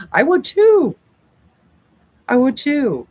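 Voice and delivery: high, loud exclamation; emphatic agreement. Real, not acted. Agreeing about wearing glasses to sew, loudly emphatic. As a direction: leap up in pitch and insist.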